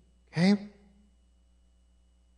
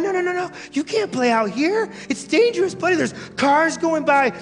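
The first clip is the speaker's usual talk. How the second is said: put-on high, urgent pleading with a small child; acted parent fussing a child back to safety; worried parent; 8 semitones higher than usual. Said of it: "You'd run out there and you'd scoop him up".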